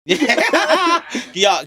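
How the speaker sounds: laughing through a yeah; giggling agreement, high and amused; real laugh while saying yeah. Real, not acted. Laughing at joke.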